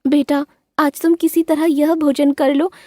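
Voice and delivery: high gentle mother bird instructing her daughter; high-voiced mother bird explaining to her daughter. A narrator's reading. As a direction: speak as a caring mother bird. Mother coaxes.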